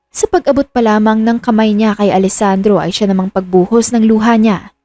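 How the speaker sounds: ordinary delivery, nothing marked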